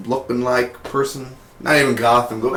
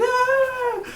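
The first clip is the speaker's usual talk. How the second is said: mock shriek. Indigo ripped in half.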